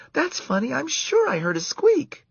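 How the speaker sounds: put-on high girlish voice, puzzled; a young camper, puzzled by a squeak. A narrator's reading. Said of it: "Karen's muffled voice say".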